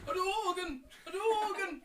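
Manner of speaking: high, loud game-fighter shout; mock arcade battle yell; attack cry. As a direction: call out the special move.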